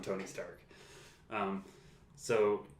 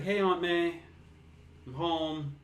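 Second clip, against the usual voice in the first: imitating Peter; 6 semitones higher than usual. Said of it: "And he's like".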